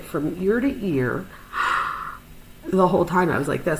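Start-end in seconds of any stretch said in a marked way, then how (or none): 1.3-2.6 gasp. Real, not acted. Sees staples ear to ear.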